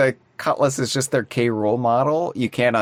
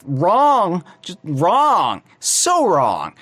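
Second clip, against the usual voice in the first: higher, punchy outburst of disbelief; indignant correction; 8 semitones higher, 3 dB louder, slower than usual. Real, not acted. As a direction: cry it out in exasperated protest. Indignant correction of trophy error.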